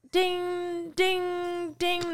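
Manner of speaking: high, ringing "ding"; evenly repeated high-pitched dings; phone alert imitation. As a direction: chime like a message notification. Imitates message alert sounds.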